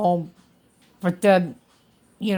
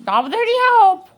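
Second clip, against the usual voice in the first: higher and louder, building; proud excitement; 9 semitones higher, 3 dB louder than usual. Real, not acted. Patient telling her vlog viewers, proudly excited. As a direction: push it up with pride. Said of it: "proudly stressing walking alone".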